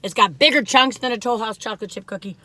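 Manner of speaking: normal talking voice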